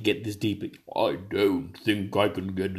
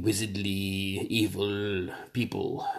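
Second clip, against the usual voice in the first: usual speaking voice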